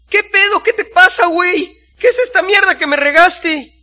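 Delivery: very high, loud, furious vendor; outraged yelling. A narrator's reading. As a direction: shriek at the man who made the mess. Vendor outraged at spilled fertilizer. (gritos agudos de indignación y coraje)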